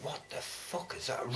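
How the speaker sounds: whispered. Retold or imitated. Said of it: "and I was like".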